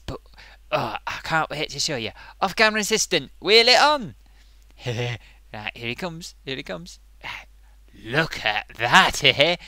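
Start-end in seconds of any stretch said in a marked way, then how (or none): 2.4-4.1 loud and high, summoning the assistant; big showman's call to bring it on. Acted. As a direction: call it out across the room. Calling out to assistant.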